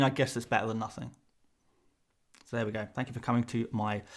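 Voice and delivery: usual speaking voice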